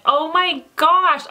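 usual speaking voice

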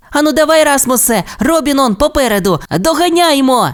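високо й завзято; підганяй друга наздогнати; підбадьорливо. Acted. Тімі підганяє Расмуса. (Тімі підганяє Расмуса: завзятий високий голос)

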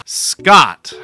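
normal talking voice

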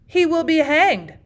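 loud, high, slow declaration; exclaimed with conviction. A narrator's reading. Host condemning the bishop's plot, exclaiming with conviction. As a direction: pronounce it slowly with firm conviction. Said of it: "he exclaimed with conviction".

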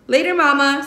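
put-on high, loud boyish call. Son shouting goodbye to mama as he runs. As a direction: toss it back while running off. Boy calling while running off.

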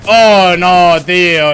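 loud, high cry of dismay; dismayed shout. Real, not acted. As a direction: groan it out loud at the screen. Player gets caught from behind. (grito fuerte de consternación)

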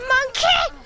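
sudden high yelled name; shout. Real, not acted. Gamer yelling at the monkey attacking him, high shout. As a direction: yell out the name in alarm.

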